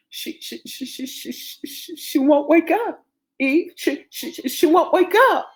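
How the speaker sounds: whispered stutter bursting into high panic; distraught stammer. Acted. Father telling Eve their baby won't wake, distraught. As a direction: stammer breathlessly, then cry it out.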